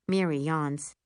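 plain reading voice

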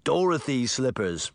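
plain reading voice

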